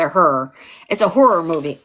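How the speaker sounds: "horror" squashed toward one syllable, "hurr"; Southern horror. Shown as a demonstration. Teacher mocking Southern speech, squashed "hurr" pronunciation. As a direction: run "horror" together like "her". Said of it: "Southern's just tripping".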